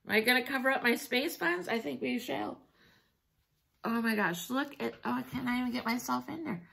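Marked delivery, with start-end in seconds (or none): none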